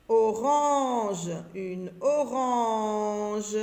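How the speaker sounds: orange said slowly with a long nasal "an"; drawn-out "an". Shown as a demonstration. Teacher letting readers hear the sound in orange, drawn out. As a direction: stretch "orange" out, lingering on ran. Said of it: "J'entends en".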